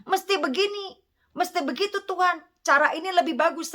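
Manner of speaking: a preacher mocks a believer who orders God around; mock bossy believer. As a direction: mimic someone telling God how to do his job. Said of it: "Jangan ngajarin Tuhan Yesus".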